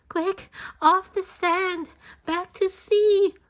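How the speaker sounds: high, loud, panicked plea; urgent cry. A narrator's reading. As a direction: hurry the whale back to the water. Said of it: "cried the snail".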